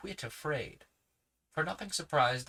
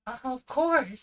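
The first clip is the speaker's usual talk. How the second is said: put-on eager children; 12 semitones higher, 8 dB louder, slower than usual. A narrator's reading. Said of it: "said Judy and Tim in the same breath".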